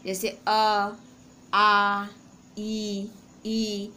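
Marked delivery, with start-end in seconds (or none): 1.5-2.1 आ held long and loud; long drawn aa. Shown as a demonstration. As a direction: stretch आ out evenly. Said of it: "जितना भी लंबा खींचें".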